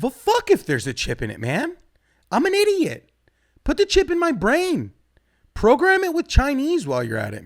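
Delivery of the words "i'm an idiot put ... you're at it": high, loud self-mocking rant; mock idiot rant. Comic telling the crowd to chip his brain, loud and high. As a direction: shout it like a gleeful fool.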